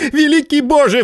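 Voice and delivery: shouted exclamation, pitched high; exclaiming. Acted. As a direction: cry out to God in disbelief. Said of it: "вскричал Варбек".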